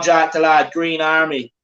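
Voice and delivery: usual speaking voice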